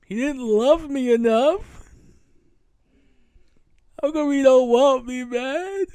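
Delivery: high, loud, drawn-out mock hurt wail; Will Smith. Joking about his own dad, mock-wounded pleading. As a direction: plead like an abandoned son, hammed up. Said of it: "Will Smith".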